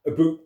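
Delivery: "Aboot" said with a long oo like boot; Canadian aboot. Shown as a demonstration. Joking about the word, saying aboot Canadian-style. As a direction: stretch "Aboot" into a boot sound. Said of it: "you gotta say it properly".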